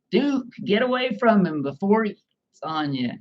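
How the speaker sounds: high-pitched father ordering the dog away; mock scolding shout. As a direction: repeat the punchline as a scolding call. Repeats the father's punchline.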